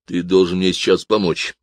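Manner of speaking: plain reading voice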